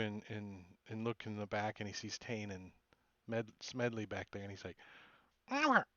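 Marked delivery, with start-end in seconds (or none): 5.5-5.8 high, squawky parrot-like mimicry; creature mimicking. The bird creature mimicking the party's names. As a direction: chirp the name back like a bird.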